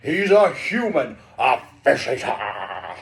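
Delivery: booming, slow shouted accusation; Triton. A narrator's reading. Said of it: "Triton shouted".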